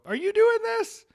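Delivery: incredulous high mock voice; high mock question. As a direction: voice jumps way up, mocking. Mocking question, pitch jump.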